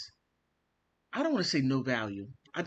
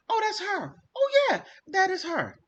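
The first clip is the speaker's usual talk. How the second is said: much higher and louder, that's her all right; excited recognition; 6 dB louder than usual. Real, not acted. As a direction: burst out in high excited recognition. Recognizing the niece's behaviour.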